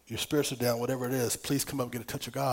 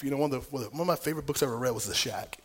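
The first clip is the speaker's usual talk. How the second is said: ordinary talk, nothing marked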